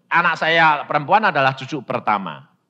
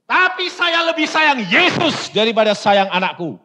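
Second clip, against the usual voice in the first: louder and higher, firm conviction; firm retort; 9 semitones higher, 6 dB louder than usual. Retold or imitated. Preacher answers his grieving wife, loud firm conviction. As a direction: stand your ground with faith.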